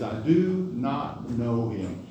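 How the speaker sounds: "I do not know him" slow, each word weighted; emphatic denial. Retold or imitated. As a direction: spell out "do not know" slowly and firmly. Peter's emphatic third denial, acted.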